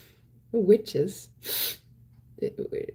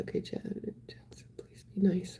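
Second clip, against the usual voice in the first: whispered; 8 dB quieter than usual. Retold or imitated. Reading a viewer's comment aloud.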